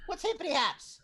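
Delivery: squeaky high dude rat. Acted. GM voices a rat.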